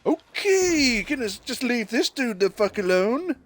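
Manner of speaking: high squeaky put-on voice; high mock voice. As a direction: raise the voice way up, hammy. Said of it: "he's just going".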